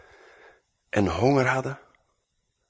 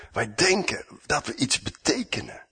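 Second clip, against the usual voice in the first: ordinary talk, nothing marked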